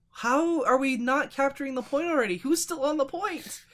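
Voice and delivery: high, loud mock exasperation; hammed-up, incredulous outcry; exasperated imitation. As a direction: mimic a frustrated player, pitch jumping up. Mimicking opponents who cannot capture point.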